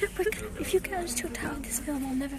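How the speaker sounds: urgent hushed whisper. Real, not acted. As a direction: whisper it so nobody hears. Whispering warning in cinema.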